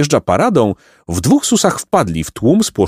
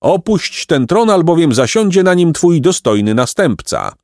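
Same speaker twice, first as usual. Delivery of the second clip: high, proclaiming herald; severe, raised-pitch proclamation; stern call; 8 semitones higher than usual. A narrator's reading. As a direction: call out the order from horseback. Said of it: "Srogi mąż wołał dalej".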